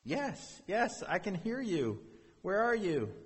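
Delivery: high-pitched, hopeful reply; acted call to an unseen rescuer; eager calling back. Stranded man answers the voice.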